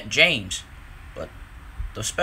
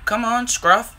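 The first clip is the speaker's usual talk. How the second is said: eager call; 8 semitones higher, 5 dB louder than usual. A narrator's reading. Whiff urging Scruff along.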